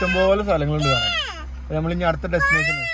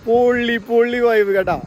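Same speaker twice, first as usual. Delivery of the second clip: thrilled exclamation, high and loud; excited; 7 semitones higher, 8 dB louder than usual. Real, not acted. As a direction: burst out with delight.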